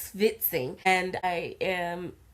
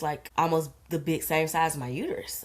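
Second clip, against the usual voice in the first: normal talking voice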